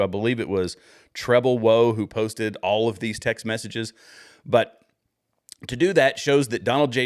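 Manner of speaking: normal talking voice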